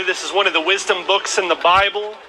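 ordinary talk, nothing marked

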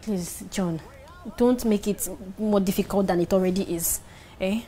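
low, subdued coaxing; soft pleading. Acted. Wife begs her husband to eat, softly pleading. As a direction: plead gently and quietly.

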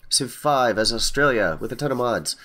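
bright, upbeat channel welcome; YouTuber intro. Acted. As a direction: greet the viewers with gusto.

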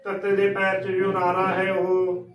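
ordinary talk, nothing marked

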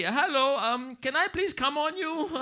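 high, hesitant polite request; comically courteous and tentative; mock polite. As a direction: ask timidly in a put-on high voice. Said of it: "maybe you ask him politely".

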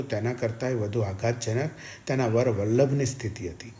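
ordinary delivery, nothing marked

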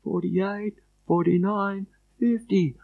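thin, high, faint counting; very soft, high far-away voice; distant voice. Acted. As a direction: count from a long way off. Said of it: "this distant voice, Shane is still counting".

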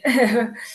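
a short, loud laugh through tears; teary laugh. Real, not acted. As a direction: laugh to hold back the tears. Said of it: "I'm gonna get teary".

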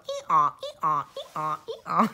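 imitating a donkey's ee-aw, breaking into a laugh; donkey braying sounds. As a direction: bray like a silly donkey for the family song. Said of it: "in charge of making the donkey sounds".

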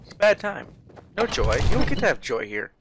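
loud, irritated telling-off; annoyed scolding. Real, not acted. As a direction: scold a humming stranger. Scolding a humming character.

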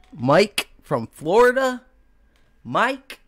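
high, loud, drawn-out greeting; excited shout-out. Real, not acted. Host greets Mike from Florida in the audience, high drawn-out call-out. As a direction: call out long and high to a friend.

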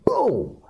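high, drawn-out "boom"; falling cartoon-style boom; imitated punch boom. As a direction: voice the punch as a sound effect.

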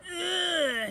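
high, shrieking yell of disgust; disgusted scream. A narrator's reading. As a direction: scream out like he's covered in slime. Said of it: "he would scream".

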